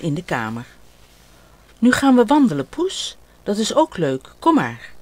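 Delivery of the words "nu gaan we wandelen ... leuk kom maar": put-on lightly raised child's voice, cheerful; a young boy coaxing his cat along; little boy voice. Ot inviting his cat to walk, playful child voice.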